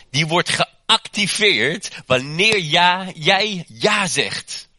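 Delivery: preacher pressing the calling on each listener; full preaching. Acted. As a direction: build it up like a pulpit climax. Preaches the calling on your life.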